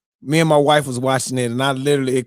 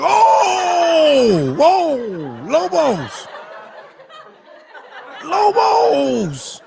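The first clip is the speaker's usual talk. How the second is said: shocked shout; much slower than usual. Retold or imitated. Watching the show with his wife, a shocked yelling laugh. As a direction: yell his name in disbelief. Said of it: "I was like".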